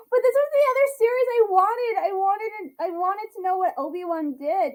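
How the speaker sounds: high, loud start, easing off; emotional excitement. Real, not acted. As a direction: gush about a long-held wish. Gushing about long-wanted series.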